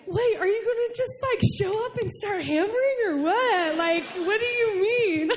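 high, baffled comeback; incredulous. Retold or imitated. Acted startled reply to God.

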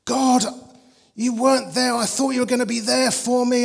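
loud, high, tight-throated plea; imitated anguished prayer. Man in church praying over his breakdown, acted anguish. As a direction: act out a raw cry to God. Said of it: "quivering on the lip".

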